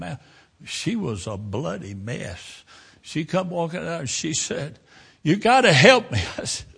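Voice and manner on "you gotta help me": high, loud, urgent begging; desperate plea. Retold or imitated. Bloodied driver begs the preacher to help her, urgent. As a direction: cry out for help like someone hurt.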